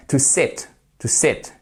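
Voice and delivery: "sit" with a short clipped i. Shown as a demonstration. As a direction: say "sit" short, not "seat". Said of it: "c'est le même que vous avez dans bit". ("sit" prononcé avec un i court)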